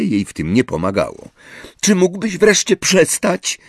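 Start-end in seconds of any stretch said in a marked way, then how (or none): 1.8-3.6 high, sharp exasperated shout; shouting at Ron. A narrator's reading. Hermione shouts at Ron over the flickering light, high and fed up. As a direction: snap at him to stop. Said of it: "krzyknęła trzeciego wieczoru".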